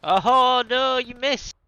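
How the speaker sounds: high, loud, startled outcry; excited shout. Real, not acted. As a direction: yell out in real dismay. Teammate misses the shot.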